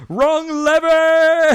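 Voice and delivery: loud drawn-out cartoon villain shriek; movie quote yell. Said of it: "Pull the lever, Kronk".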